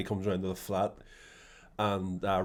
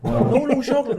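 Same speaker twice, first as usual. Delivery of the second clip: high, loud, excited correction; emphatic no; 10 dB louder, slower than usual. Real, not acted. Correcting his friend about Van Damme, loud and high. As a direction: cut in, way up and louder.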